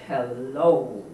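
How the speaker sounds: low, menacing purring hello; villainous cat voice; sly cat greeting. Acted.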